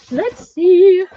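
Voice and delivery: high, loud, sung-out let's see; sing-song call. Real, not acted. Sorting stencils, she sings out the phrase high and loud. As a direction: chirp it out brightly like a little tune.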